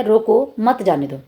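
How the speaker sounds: "रोको", pause, then "मत जाने दो"; slowly, breaking between रोको and मत; comma after रोको. Shown as a demonstration. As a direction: set रोको apart, fall on "जाने दो". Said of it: "मतलब रोक लो".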